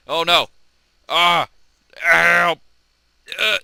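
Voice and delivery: high, loud screaming for help; mock screams. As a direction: shriek in hammed-up panic. Character crashing in game.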